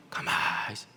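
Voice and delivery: hushed under-breath comment; whispered aside. Retold or imitated. Preacher teasing a silent elder, whispered. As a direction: whisper it as if not to be heard.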